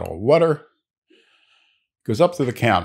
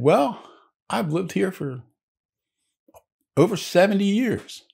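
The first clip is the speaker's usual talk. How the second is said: put-on high-pitched old-timer character voice; old New England man; 10 semitones higher than usual. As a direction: play an old local man, pitched well up. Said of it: "the guy behind the counter, the old New England guy".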